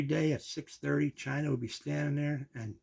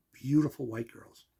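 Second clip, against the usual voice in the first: savoring. Retold or imitated. Repeating it, savouring.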